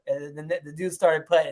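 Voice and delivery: usual speaking voice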